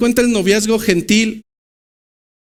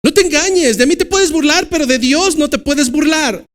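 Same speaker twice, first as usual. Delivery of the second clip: higher, insistent voicing of Pablo; Pablo's warning; 6 semitones higher than usual. Preacher voicing Pablo warning the church, higher and insistent. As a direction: warn them firmly as the apostle. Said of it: "Pablo tuvo que dejar claro".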